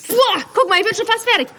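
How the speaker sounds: high, eager exclamation; proud and thrilled to be done; excited. Real, not acted. Excited while inflating cactus.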